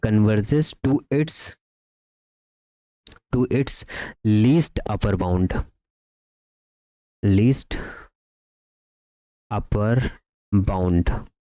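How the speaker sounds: measured, slow delivery for note-takers; slow dictation. Real, not acted. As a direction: dictate word by word, with long pauses. Dictating the result to write.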